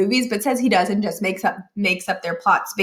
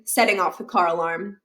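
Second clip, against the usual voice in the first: plain reading voice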